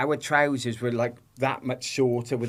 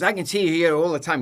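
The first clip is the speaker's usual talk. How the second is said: higher and louder, imitating a hostile officer; mock aggressive officer; 5 semitones higher, 6 dB louder than usual. Retelling the passport officer, hammed-up hostile and loud. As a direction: throw the line at him like a rude official. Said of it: "He goes".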